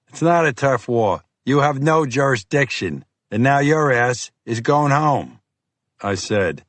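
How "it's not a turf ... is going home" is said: lightly voiced gruff FBI agent; pushy, impatient Boston cop. Fiore dismisses Bennett.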